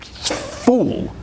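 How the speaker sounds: ordinary talk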